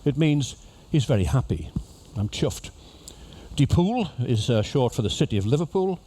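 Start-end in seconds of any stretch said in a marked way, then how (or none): 2.2-2.7 softer Liverpool accent; Scouse accent. Shown as a demonstration. Repeating the local phrase for passengers in Scouse accent. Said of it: "if somebody in Liverpool says". 3.6-4.1 lightly shown Liverpool accent; Scouse accent. Shown as a demonstration. Teaching passengers the city's short name in Scouse accent. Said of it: "the Liverpool accent starts just at".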